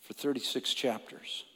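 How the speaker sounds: softly, almost to himself; a hushed, heavy statement. Real, not acted. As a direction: drop the voice to a hush. Dramatic pause on Job's suffering.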